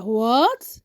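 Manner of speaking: voiced, disbelieving question; shocked whisper. A narrator's reading. Ade reads of Zara's success, shocked question. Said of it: "Adewale whisper".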